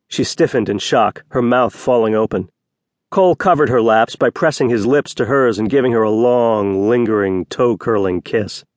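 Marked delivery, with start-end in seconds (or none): none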